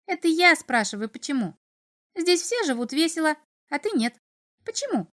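high, pestering little imp. A narrator's reading. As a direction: needle him like a curious imp. Imp character questions.

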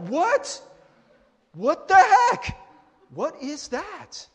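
high, drawn-out baffled outburst; stunned disbelief. Retold or imitated. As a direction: exclaim in hammed-up shock, stretching the words. Stunned child reacts to dad's switch.

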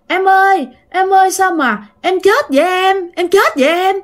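high, mock wailing lament over a pretend dead sister; a child hamming up grief in a make-believe game; playful fake sobbing, pitched high; pretend crying. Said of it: "chị nhớ khóc".

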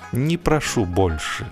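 plain reading voice

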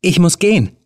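trying to sound calm but scared; fear in every syllable. A narrator's reading. Helen to the man blocking the door, fear seeping through. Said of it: "Angst zwischen jede Silbe sickerte".

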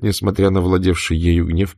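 ordinary delivery, nothing marked

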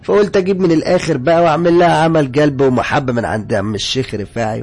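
loud, high indignant outburst; upset. Acted. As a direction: protest at full voice, stretching the line endings. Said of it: "لكن فضل زعل وقال لي". (زعيق واحد زعلان ومتغاظ)

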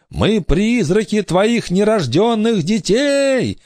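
high, slow wailing announcement; ghostly proclamation. A narrator's reading. Girl announces herself to pirate, ghostly proclaiming voice. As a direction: proclaim it like a spirit come to haunt. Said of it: "объявила Корделия".

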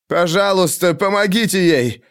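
a hint of begging; pleading. A narrator's reading. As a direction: beg them for help. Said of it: "умоляющим тоном произнёс Рафаэль".

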